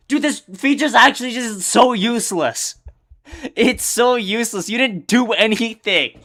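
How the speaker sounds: fed-up rant at a broken tool; annoyed rant. Real, not acted. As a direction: keep venting, still worked up and loud. Rants on about the useless feature.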